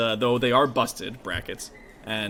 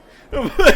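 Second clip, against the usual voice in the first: loud high laugh; burst of laughter; 13 semitones higher, 15 dB louder than usual. Real, not acted. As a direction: crack up at the table. Laughing at a player's yell.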